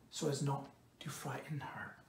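soft breathy narration, voice barely there; hushed whisper. A narrator's reading. Narrator whispering Enkidu's careful approach to Shamat. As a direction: whisper it, as if not to scare her off.

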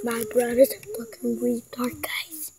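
unvoiced whisper to the camera. Real, not acted. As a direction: whisper it secretly. Secret aside to viewers.

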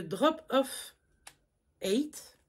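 English product name in a French accent; drawn-out English words, real French accent. Real, not acted. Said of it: "pour mon anglais".

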